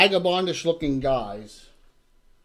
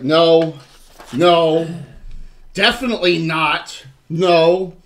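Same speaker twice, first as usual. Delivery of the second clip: put-on loud, firm old man refusing everyone; old gentleman refusing; 3 semitones higher, 11 dB louder, much slower than usual. Old gentleman turning away prisoners, loud refusal. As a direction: shut them down hard, old and stubborn. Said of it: "And he's like".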